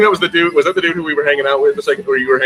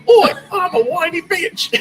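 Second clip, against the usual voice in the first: high, drawn-out whiny British voice; exaggerated whiny impression, way up in pitch; Draco Malfoy; slower than usual. Mocking Malfoy for laughs, hammed-up whiny British voice. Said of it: "Let's hear your best Draco Malfoy impression".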